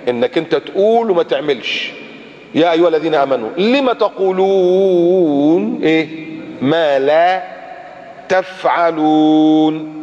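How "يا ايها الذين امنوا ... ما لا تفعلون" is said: slow chanted recitation; slow melodic tajweed recital; Quran recitation. Acted. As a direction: draw out the verse reverently.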